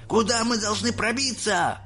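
high, alarmed cry without extra volume; cried out. A narrator's reading. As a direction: call the question out high. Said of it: "прокричал дедушка Джо". (тревожный высокий выкрик)

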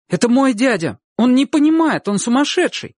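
put-on high, loud, urgent boy's voice; pleading boy. A narrator's reading. Boy excusing his uncle to the crowd, high urgent pleading. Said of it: "Стал Чик оправдывать дядю".

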